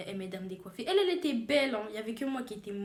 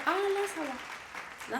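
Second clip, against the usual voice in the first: high, drawn-out "ah" of relief; relieved; 7 semitones higher, much slower than usual. Retold or imitated. Seeing herself after the new hairdo, relieved. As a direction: stretch it out high, finally satisfied.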